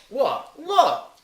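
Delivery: high indignant What. Real, not acted. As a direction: act mock outrage, high.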